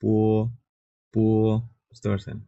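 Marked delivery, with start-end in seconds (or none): 0.0-1.2 পো said as a plain unaspirated p with a long o; Chinese bo demo. Shown as a demonstration. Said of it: "পয় ওকারের মতো একটা উচ্চারণ আসতেছে".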